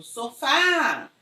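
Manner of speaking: long, drawn-out FÁ in sofá; sofá with a held stressed ending; stress on last syllable. Shown as a demonstration. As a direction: stretch the final FÁ of sofá. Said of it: "Se a sílaba tônica for a última".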